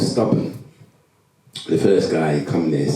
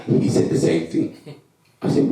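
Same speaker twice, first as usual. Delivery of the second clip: usual speaking voice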